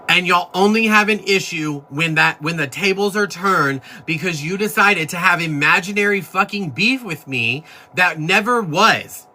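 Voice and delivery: indignant. Real, not acted. Accusing critics of hypocrisy.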